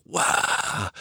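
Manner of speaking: breathy hushed wow in awe; whispered wow. Retold or imitated. Woman marvelling during sex, whispered. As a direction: let it slip out as a whisper. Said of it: "And she's like".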